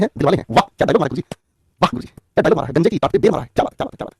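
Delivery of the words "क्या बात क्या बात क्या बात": mock whisper. Praising himself in mock whisper.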